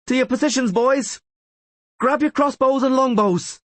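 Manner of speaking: high, loud shouted command; battle order. A narrator's reading. Rayla shouting orders to her soldiers before battle. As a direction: bellow it to the troops.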